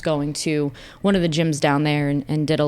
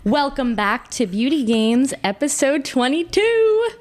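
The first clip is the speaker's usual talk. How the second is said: slow, raised host announcement; show host intro; 7 semitones higher than usual. Acted. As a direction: welcome them in like a host. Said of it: "show intro announcement".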